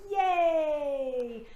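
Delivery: high, loud joyful cheer; childlike whoop of delight; cheerful yay. Shown as a demonstration. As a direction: cheer it out with arms up. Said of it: "Let's try that again".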